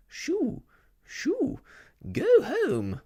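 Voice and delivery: very high, loud shrieks to chase off a dog; shrill shooing cry. Acted. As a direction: shoo the mongrel away at the top of your voice. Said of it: "she cried".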